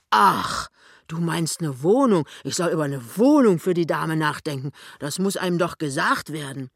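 loud burst of dawning understanding; loud, slightly put-out tradesman; blustering shopkeeper husband. Acted. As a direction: grumble loudly that nobody told you. Voices the fat husband.

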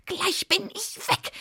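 high, strained witch fury; peevish high hiss; hissed threat. A narrator's reading. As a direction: insist angrily at a high pitch. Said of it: "fauchte die Zwickurlaub".

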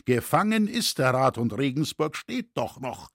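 plain reading voice

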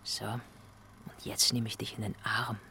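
said softly and a little slow. Acted. Actor softly rehearsing the hug line, quiet. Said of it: "quiet line in rehearsal".

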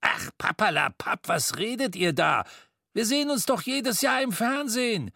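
high, dismissive miser's voice; dismissive old miser. A narrator's reading. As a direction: brush off the nonsense. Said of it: "gab Mister Scrooge zurück".

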